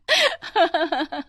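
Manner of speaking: high-pitched teasing laughter; mocking laugh. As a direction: laugh at someone's clumsy fall. Laughs at the fallen woman. (मज़ाक उड़ाती हँसी)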